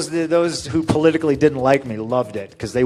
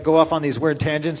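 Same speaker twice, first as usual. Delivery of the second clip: ordinary talk, nothing marked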